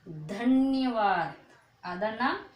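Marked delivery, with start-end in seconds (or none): 0.1-1.3 धन्यवाद drawn out slowly, the half न said quickly into या; धन्यवाद modelled slowly for beginners; slow teaching demo. Shown as a demonstration. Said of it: "तो कैसे बोलोगे?".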